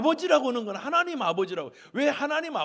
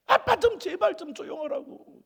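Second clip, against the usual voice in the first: high, loud whiny daughter voice; a sleepy daughter snapping at dad; annoyed daughter; 10 semitones higher, 4 dB louder than usual. Retold or imitated. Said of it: "잠자던 딸이 뭐라고 하는지". (짜증 난 딸의 높은 목소리)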